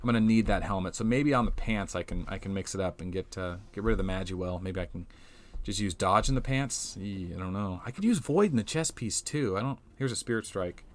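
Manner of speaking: usual speaking voice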